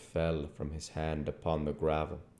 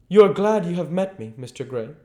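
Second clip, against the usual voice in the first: higher, fast, louder teasing aristocrat; Lord Henry's voice; 12 semitones higher, 10 dB louder, faster than usual. Acted. Lord teasing Dorian after their talk, quick and playful. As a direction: needle the young man playfully. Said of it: "said Lord Henry".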